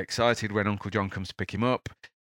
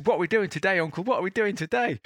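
put-on a six-year-old tugging at his uncle at dawn; excited little boy; 11 semitones higher, 4 dB louder than usual. Logan waking his uncle, high excited child voice. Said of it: "He says".

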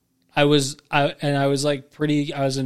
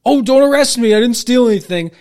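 much higher, louder, hammed-up panic; mock pleading; 9 semitones higher, 9 dB louder than usual. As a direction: play the panicked innocent shopper. Mocking accused shoplifter.

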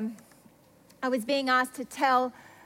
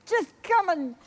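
earnest pleading; 3 semitones higher than usual. Retold or imitated. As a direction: plead with God, voice climbing to the end. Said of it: "I'm humbling myself".

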